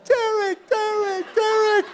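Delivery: loud high chant of a name; calling out. Retold or imitated. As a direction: call the name out like a cheering crowd. Crowd calling his name.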